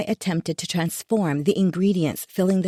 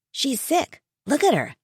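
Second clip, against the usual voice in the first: put-on teen son voice; 8 semitones higher than usual.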